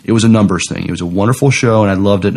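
ordinary talk, nothing marked

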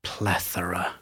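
hushed, softly voiced single word; joke whisper. Stranger turning to a widow at a funeral, mock whisper. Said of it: "just whispers".

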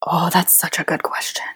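whispered, impressed aside; hushed oh of delight. Real, not acted. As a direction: whisper it as if stunned by the question. Tense question about copywriters.

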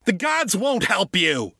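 high, harsh retort; angry father, pitch jumped up. A narrator's reading. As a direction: throw it back at the crying boy. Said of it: "Father retorted".

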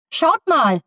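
high excited call. Acted. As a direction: point upward, call out to friends. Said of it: "rief Tino aus".

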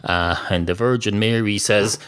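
ordinary delivery

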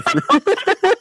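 high-pitched laughter breaking through; laughing helplessly. Real, not acted. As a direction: laugh through the name Tracy.

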